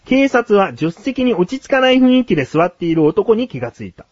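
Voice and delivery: story narration. A narrator's reading. Host telling co-host of a roadside proposal, story narration. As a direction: narrate it like a feature news tale.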